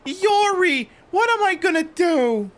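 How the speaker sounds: high-pitched lamenting mother voice; despairing mother. Acted. Mother left without her daughter Yori, high despairing lament.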